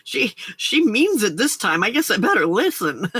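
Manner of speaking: sing-song, swooping mock-kid voice; imitated wary pupils. Imitating pupils who realise teacher is serious, mock wary. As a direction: play impressed kids, amused and hammed-up. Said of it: "when my teacher voice came on, kids were like".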